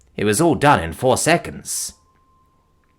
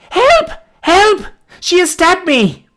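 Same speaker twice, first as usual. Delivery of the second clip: terrified cry; 6 dB louder, slower than usual. A narrator's reading. Girl stabbed, crying for help.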